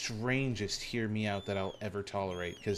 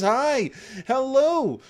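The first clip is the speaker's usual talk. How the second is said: high, loud flustered hellos; overexcited high-pitched welcome; excited greeting; 11 semitones higher, 11 dB louder than usual. Real, not acted. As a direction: squeal hellos at surprise guests. Greets a crowd of surprise raiders.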